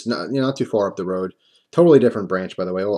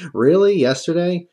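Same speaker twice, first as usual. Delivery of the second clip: high, loud disbelieving really; shocked he beat me to it; surprised; 8 semitones higher, 5 dB louder, slower than usual. Retold or imitated. To the teller, high loud disbelief.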